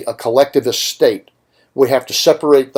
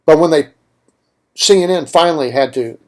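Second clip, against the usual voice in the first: ordinary talk, nothing marked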